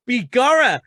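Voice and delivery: hammy stage Irish exclamation; loud mock Irish "Begorra". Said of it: "I've gone a bit flatly early".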